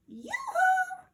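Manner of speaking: very high sung-out yoo-hoo; mouse calling out. Acted. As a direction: hail friends across the pond. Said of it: "she shouted".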